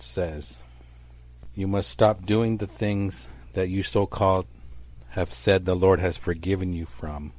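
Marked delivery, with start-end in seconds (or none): none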